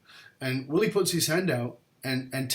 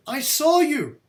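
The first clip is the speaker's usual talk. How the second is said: much higher, louder outraged cry; indignant shout; 13 semitones higher, 8 dB louder, slower than usual. Retold or imitated. Young man insists to his teacher, shouting in outrage. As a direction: fling the accusation back at him. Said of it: "And I said".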